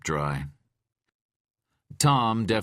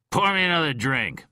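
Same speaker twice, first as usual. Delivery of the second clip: loud, higher command; ordering; 11 semitones higher, 5 dB louder than usual. A narrator's reading. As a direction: bark the order. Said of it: "he ordered".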